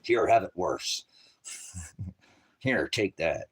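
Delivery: lightly voiced smug God; God's reply. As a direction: toss it back, slow and low. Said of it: "He's like".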